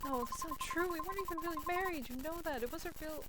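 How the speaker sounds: high-pitched pleading denial; defensive protest. Acted. Acted quarrel over moving on.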